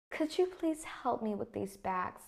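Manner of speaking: polite command with a falling end on "bags"; falling intonation. Shown as a demonstration. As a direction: let the pitch fall away towards "bags". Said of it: "my voice tone decreases towards the end".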